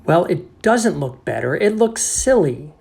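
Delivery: loud, climbing high in annoyance; hammed-up irritation; exasperated retort. Narrator rejects the janitor's excuse, exasperated.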